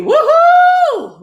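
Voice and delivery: very high, loud party whoop. Acted. Character cheering about the party, high whoop. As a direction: let out a giddy cheer.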